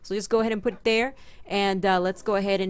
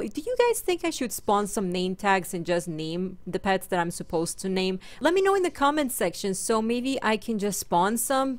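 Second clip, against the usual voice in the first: ordinary talk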